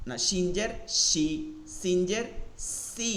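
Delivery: সি slow, with a sh sound; shin as shi. Shown as a demonstration. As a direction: say সি as shi, slowly. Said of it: "সি এর শব্দ আসা যাবে না". (ধীরে, শ এর মতো সি)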